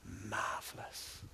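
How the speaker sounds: very slow, hushed whisper; soft, drawn-out imitation of the dying; weak last word. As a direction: breathe it out faintly, as her last word. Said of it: "We whispered in her ear".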